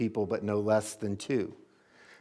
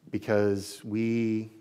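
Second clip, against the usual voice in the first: closing prayer; 2 semitones lower than usual. Acted. Pastor leads closing prayer.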